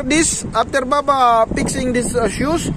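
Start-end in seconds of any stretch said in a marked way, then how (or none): none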